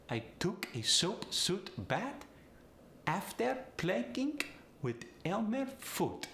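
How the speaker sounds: Polish accent with final D as T; high Polish accent, "Fudd" as "Foot". Shown as a demonstration. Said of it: "Switch that D to a T".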